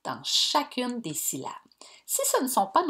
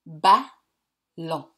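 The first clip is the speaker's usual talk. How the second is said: Ballon split into two clear beats, ba-llon; Ballon, each syllable pushed out loud; syllables tapped out; 7 dB louder than usual. Shown as a demonstration. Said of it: "regarde bien combien de coups je vais donner avec ma voix".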